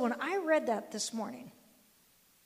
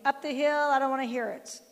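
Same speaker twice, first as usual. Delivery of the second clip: louder, firm order without emotion; stern command; 2 semitones higher, 7 dB louder than usual. Retold or imitated. Grandma ordering grandson uphill, stern and loud. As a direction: bark it flat, no feeling. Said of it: "No emotion whatsoever".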